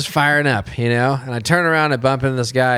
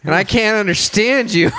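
higher-pitched mocking jab, near laughter; retold drunk trash talk, pitched up; douchebag taunt; 7 semitones higher than usual. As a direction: taunt him like a jerk. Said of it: "you went full douchebag mode".

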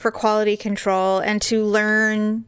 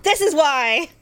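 mock outraged; 6 semitones higher than usual. Caps her mock rant, raised pitch.

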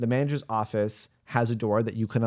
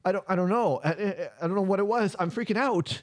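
high, panicked rambling; freaking out; 9 semitones higher, faster than usual. Acted. [name] faking panic to the group, high and stammering. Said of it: "[name] looks so distraught".

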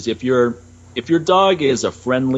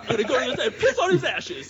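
very high, hammed-up gangster tirade; Robert De Niro; 13 semitones higher, 6 dB quieter than usual. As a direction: rave like a furious mob boss, without full volume. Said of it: "all pissed off".

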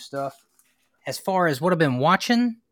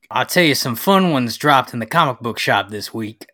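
louder hammed-up Southern accent; put-on Southern accent; 2 semitones lower, 6 dB louder than usual. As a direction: play a Southern comic shop regular. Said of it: "he's keeping the accent".

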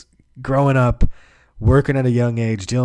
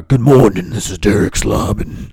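altered caller voice; 6 dB louder than usual. Retold or imitated. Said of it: "constantly alter my voice".

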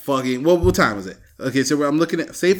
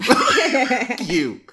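laughing mock curse, pitched higher; 7 semitones higher, 3 dB louder than usual. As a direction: crack up through the curse.

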